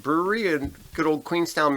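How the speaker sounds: usual speaking voice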